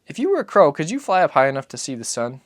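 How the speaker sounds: put-on high, light child's voice; a small boy asking his father; boy's voice. Narrator voices the boy asking Papa, high and childlike.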